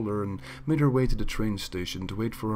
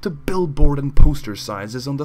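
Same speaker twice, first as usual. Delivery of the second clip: manic loud order; 3 semitones higher, 9 dB louder than usual. Acted. Hysterical baker ordering copies.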